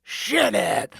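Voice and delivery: loud gruff snarl. Acted. Sid snarling at Jake. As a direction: cut him off. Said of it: "the leader snarled".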